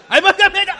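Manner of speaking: high, loud alarmed halt; laughing panicked protest; alarmed stop. Real, not acted. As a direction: cut him off in a panic. Stopping him in alarm.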